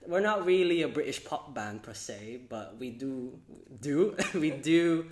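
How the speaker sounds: posh put-on British accent. Shown as a demonstration. Host demonstrates a British accent to the guest, posh British. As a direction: play a British pop band member, posh accent. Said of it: "I could demonstrate my British accent".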